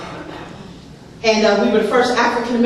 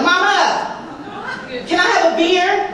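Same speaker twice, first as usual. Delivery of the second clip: put-on much higher, sweet kid voice; childlike asking; 7 semitones higher than usual. Girl asking her mother for beer, much higher and sweet. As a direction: ask mama sweetly like a little girl. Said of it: "I was like".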